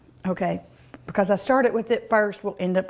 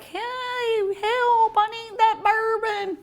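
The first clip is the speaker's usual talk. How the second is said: high-pitched mock cry for help; comic falsetto begging voice; pleading bourbon-starved pan; 12 semitones higher than usual. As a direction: squeal a playful plea, much higher than usual. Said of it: "It was like".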